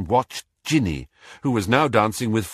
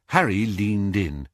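ordinary delivery, nothing marked

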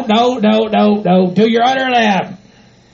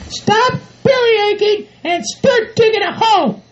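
high falsetto scolding voice; bossy partner; 12 semitones higher, slower than usual. Acted. Bride telling groom to bury the manure, bossy. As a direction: lay down the law in a high put-on voice.

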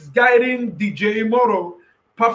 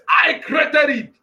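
higher, shouted, crying out; thundering God; 4 semitones higher than usual. Acted. Preacher as God, shouting at those who question him. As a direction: cry out as an outraged creator. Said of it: "That's what God is asking somebody".

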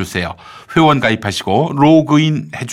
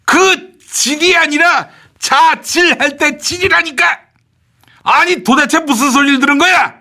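exasperated shout; 13 semitones higher than usual. Acted. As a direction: stretch it out, fed up. Shouting correction. (높고 느리게 답답해서 외침)

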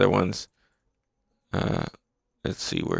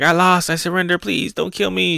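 higher, pleading surrender; lightly voiced girl begging not to be killed; mock pleading; 8 semitones higher than usual. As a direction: beg for your life in a higher voice. Retelling manga, Nami begging.

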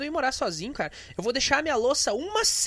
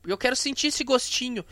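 ordinary delivery

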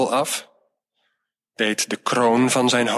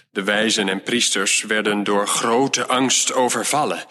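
plain reading voice

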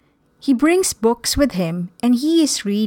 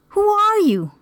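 amazed; 10 semitones higher than usual. Acted. Richard jumps at seeing God in his room, amazed. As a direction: ask in amazement, pitch lifted high. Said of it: "Richard jumps in amazement".

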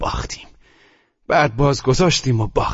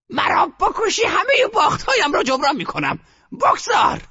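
grandmother shouting. A narrator's reading. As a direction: shout down all objection. Grandmother demands the bet.

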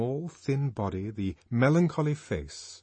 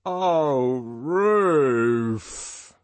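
loud, long swooping cry of joy; enraptured, swelling exclamation; voice of rapture; 5 semitones higher, 6 dB louder than usual. A narrator's reading. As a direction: draw it out in ecstasy. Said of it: "he repeated in a voice of rapture".

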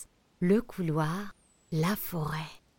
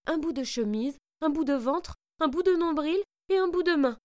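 faster, higher and louder; rising excited narration; 9 semitones higher, 3 dB louder than usual. A narrator's reading. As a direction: build the greedy frenzy. Wolf greedily tears pieces.

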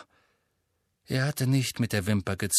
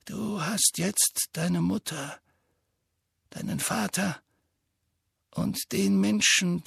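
put-on gentle old headmaster; 7 semitones higher, 3 dB quieter than usual. A narrator's reading. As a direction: comfort the boy, unhurried. Dumbledore consoles Harry.

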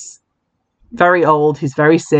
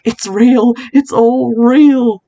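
higher, slow mock alarm; mock frantic realization; 8 semitones higher, 4 dB louder, much slower than usual. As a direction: stretch out it's all real in mock panic. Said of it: "she calls Jill and is like".

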